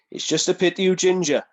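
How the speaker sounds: loud, high put-on voice; mock veteran's jibe. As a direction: deliver the jibe as a big impersonation. Voices wrestler's parting jibe in story.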